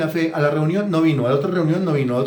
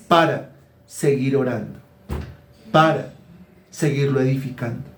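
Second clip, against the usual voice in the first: ordinary talk, nothing marked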